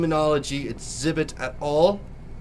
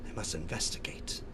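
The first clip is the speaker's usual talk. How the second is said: hushed whisper; 9 semitones lower, 14 dB quieter than usual. Acted. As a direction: whisper it, unvoiced and very soft. Reading a mysterious letter.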